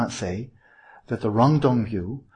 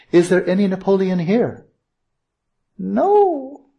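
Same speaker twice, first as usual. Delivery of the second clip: emphatic no; 8 semitones higher, 6 dB louder, slower than usual. Acted. Teacher asks and answers emphatically.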